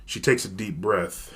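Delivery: ordinary delivery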